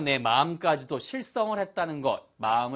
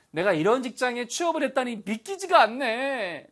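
amazed disbelief; 9 semitones higher, 3 dB louder than usual. Acted. Teacher acts landing such a job, amazed. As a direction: marvel in a raised voice.

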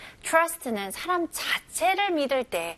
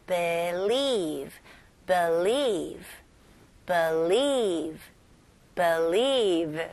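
believe with weight on lieve; stress on second syllable; 4 semitones lower, much slower than usual. Shown as a demonstration. Teacher drills believe, stress on lieve. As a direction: hit lieve, keep be light. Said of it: "강세가 어디에 들어갔어요".

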